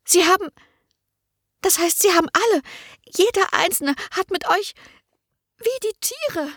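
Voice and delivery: hohe, fassungslose Stimme; schockierte Magd. A narrator's reading. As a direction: entsetzt und stockend, hoch gesprochen. Merle shocked, high. (Merle reagiert entsetzt auf Annas Geständnis)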